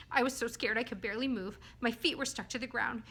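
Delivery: ordinary delivery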